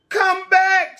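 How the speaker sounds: high, loud call; shout. Acted. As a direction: call after him loudly. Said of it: "shouts Beatrice".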